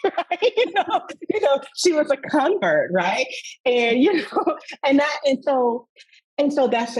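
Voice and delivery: high, loud, laughing through words; delighted chuckling talk. Real, not acted. Speaker laughing about her mom becoming a convert. Laughing after mom's story.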